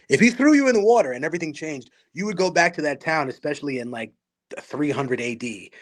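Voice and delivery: normal talking voice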